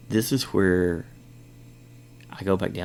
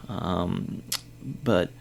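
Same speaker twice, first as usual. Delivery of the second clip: ordinary talk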